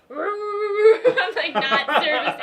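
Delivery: loud laughing words. Real, not acted. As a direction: laugh through it, slow. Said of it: "he would not be good".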